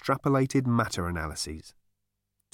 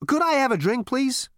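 high, loud brash Zaphod; cocky Zaphod voice; 14 semitones higher, 4 dB louder than usual. Acted. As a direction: play it cool and cocky.

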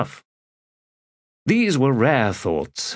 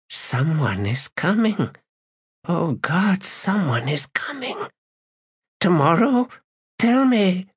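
rising panic; 10 semitones higher than usual. A narrator's reading. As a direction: panic building, plead high. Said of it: "sudden fear ripped through her".

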